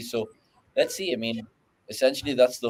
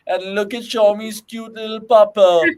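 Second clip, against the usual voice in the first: higher, slower, louder doting voice; cooing over a puppy; 8 semitones higher, 7 dB louder than usual. Real, not acted. Host spots a co-host's puppy on screen, cooing. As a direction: gush over the cute dog.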